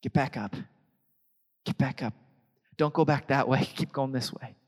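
soft, gentle reassurance; soft encouragement. Retold or imitated. Church gently encourages a fallen brother, softly. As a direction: comfort someone who fell. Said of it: "a place that says".

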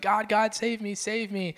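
imitated cry to heaven, not a full shout; calling out to God. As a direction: act out a drowning man's cry. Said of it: "he's calling out to God".

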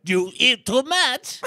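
high, slow mock voice; silly movie-riff voice. Riffing on a food-drug movie, silly high voice. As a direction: draw it out in a loud, high put-on voice.

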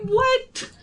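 a high "What?" that breaks into a laugh; shocked, half laughing, at the silence; laughing disbelief. Retold or imitated. Surprised by no responses.

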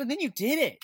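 high, emphatic quote of a friend; amazed praise. Retold or imitated. Old friend to singer about his dream, amazed.

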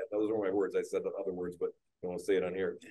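usual speaking voice

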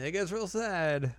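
high, slow, drawn-out fake sadness; mock sad.

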